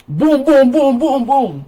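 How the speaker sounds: high, punchy chant of triumph; excited celebration. Real, not acted. Celebrating she guessed the capital right, high triumphant chant. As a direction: bounce each boom up high like a victory cheer.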